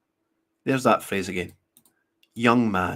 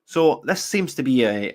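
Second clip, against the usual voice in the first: usual speaking voice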